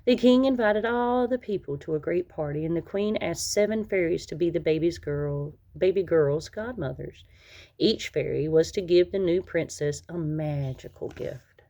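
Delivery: ordinary delivery, nothing marked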